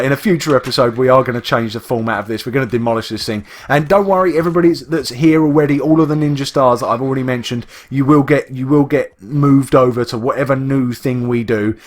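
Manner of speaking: ordinary talk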